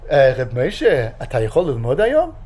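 the rabbi phoning his student; lightly voiced rabbi. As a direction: slip lightly into the rabbi asking. Rabbi's voice on the phone.

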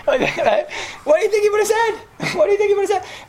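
very high-pitched exclaimed question; mock incredulous. Rabbi challenging listeners on what Abraham would say, high mock incredulity.

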